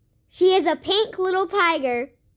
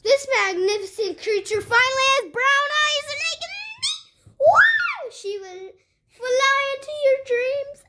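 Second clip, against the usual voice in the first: excited fast; 6 semitones higher than usual. Pretend. Fast high playful toy intro.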